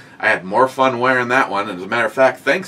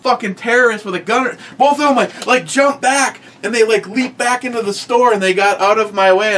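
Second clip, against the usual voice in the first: higher, disbelieving story voice; incredulous; 9 semitones higher, 4 dB louder than usual. Real, not acted. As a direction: tell it in rising disbelief. Mocking people jumping back.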